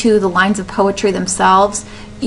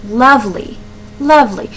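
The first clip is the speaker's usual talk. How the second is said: "Lovely" with the first syllable leaning hard, falling away; demonstrating front stress on "Lovely". Shown as a demonstration. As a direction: stress the start of "Lovely", let the end almost disappear. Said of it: "emphasis is on the beginning".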